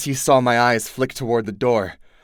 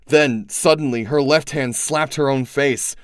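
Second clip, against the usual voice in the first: ordinary delivery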